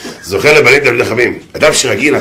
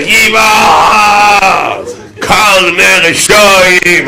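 high, long-stretched cries; hammed-up drawn-out howl; acted screaming; 7 semitones higher, much slower than usual. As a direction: keep wailing, dragging out every syllable.

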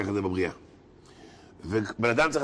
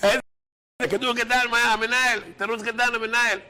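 high, mock-indignant repeat; mocking coach; 9 semitones higher than usual. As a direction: throw his excuse back at him. Coach mocks the excuse.

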